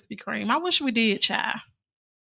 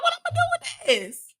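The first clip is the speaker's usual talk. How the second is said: incredulous squeak; 5 dB quieter than usual. Retold or imitated. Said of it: "Be like".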